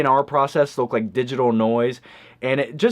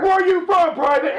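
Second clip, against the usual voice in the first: drill sergeant. Acted.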